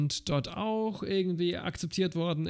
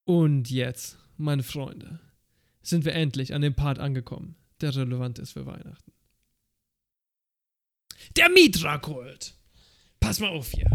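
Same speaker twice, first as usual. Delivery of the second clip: put-on booming kids show opener; 2 semitones lower than usual. Addressing friends, big opener.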